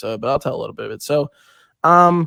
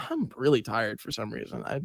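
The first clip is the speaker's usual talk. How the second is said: tired murmur; 2 semitones lower, 11 dB quieter than usual. Retold or imitated. Retelling feeling sick on bus.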